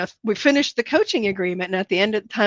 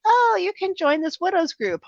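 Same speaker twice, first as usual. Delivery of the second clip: mock helpful voice; 9 semitones higher than usual. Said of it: "people are like".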